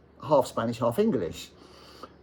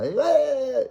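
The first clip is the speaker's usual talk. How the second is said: cheer; 6 dB louder than usual. Retold or imitated. Imitating the crowd greeting.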